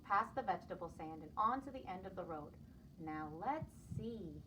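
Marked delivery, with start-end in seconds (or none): 3.0-4.4 slow, soft musing; pondering. Acted. Buddy pondering at the fork, slow and soft. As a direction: think it over slowly. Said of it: "he pondered".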